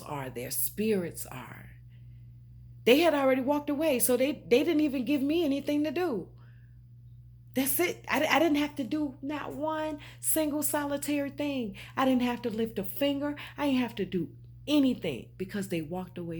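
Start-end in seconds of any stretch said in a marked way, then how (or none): 2.8-13.7 real fired-up release, words tumbling out; fired-up vindication. Real, not acted. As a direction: let it burst out in triumph. Vindicated outburst about people leaving.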